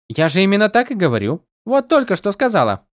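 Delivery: high, light and teasing; a tiny sprite needling her friend; playful spren voice. A narrator's reading. Голос Сил. (высокий игривый голос духа)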